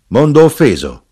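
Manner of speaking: loud, high scoffing dwarf, building; shouted question. A narrator's reading. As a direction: cry it back as a rising shout. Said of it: "gridò l'impudico nano del vino".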